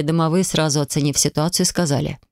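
ordinary delivery

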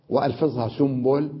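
normal talking voice

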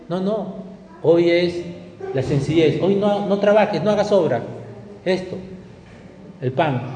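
ordinary talk, nothing marked

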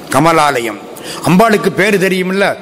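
ordinary delivery

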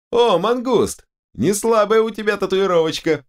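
very high, amazed character voice; impressed exclamation. A narrator's reading. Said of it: "присвистнул вездесущий Стинг". (восхищённый возглас высоким голосом)

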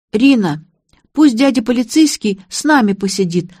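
put-on childish wheedling. A narrator's reading. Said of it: "законючила Леся".

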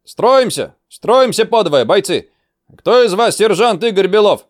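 high, loud calling out; shouted roll call. Acted. Instructor shouts orders.

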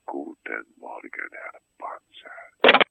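low, slow, fading to a whisper; hushed goodbye. Real, not acted. Sculptor ending an unwanted call, hushed and restrained. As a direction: sign off with strained restraint.

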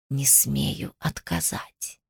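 very slow, hushed low serpent; hissing snake. A narrator's reading. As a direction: hiss it barely above a whisper, drawn out. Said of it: "прошипела змея". (еле слышное, медленное змеиное шипение)

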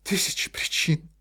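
breathless high sobbing repeat; sobbing echo. A narrator's reading. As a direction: gasp the words through a sob. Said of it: "всхлипнула Ольга Ивановна".

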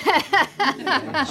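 a high peal of laughter; high delighted laugh. Acted. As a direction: Duchess laughing at the palmist's thrift remark, high laugh. Duchess amused at palm reading.

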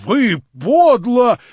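медленно, громко, с негодованием; бросить в лицо упрёк; возмущённо. Acted. Выкрик обвинения. (возмущённый выкрик)

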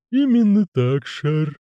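slow, high creaky lisping servant; creaking groaning door voice. Acted. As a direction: drawl it like a creaky manservant. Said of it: "беспристрастно ответил Игорь".

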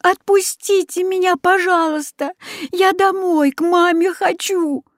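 put-on very high, weeping child; girl crying to go home to mama; tearful plea. A narrator's reading. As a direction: beg through sobs. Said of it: "заплакала Люська".

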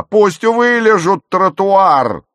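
high, loud, drawn-out yell; furious full-voiced shout; bellowed order. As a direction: scream it out, stretching the words. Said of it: "орала она".

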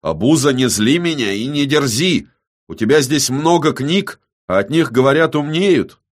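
heated soldier losing patience; starting to seethe. A narrator's reading. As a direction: warn him off as your temper boils up. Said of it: "начал закипать здоровяк".

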